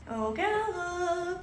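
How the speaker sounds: high, slow pet-calling voice; singsong calling. Real, not acted. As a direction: stretch the name out high and singsong. Calling sleeping dog in singsong.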